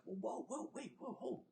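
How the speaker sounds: a hint of suspicious residents; alarmed locals. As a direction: imitate wary locals stopping you. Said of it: "people start coming out of the house like".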